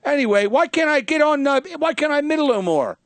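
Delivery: high whiny Woody Allen impression; comic doing Woody Allen for friends. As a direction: kvetch like a nebbishy New Yorker.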